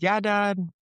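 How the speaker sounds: high-pitched imitation of a kid; mock little-boy reply; young son's voice. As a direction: lift it up like a child answering Dad.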